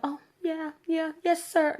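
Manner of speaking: high, sarcastic, fake-obedient "yes, sir"; mock meek. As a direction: play the meek little woman, mockingly. Mocking submissive reply she rejects.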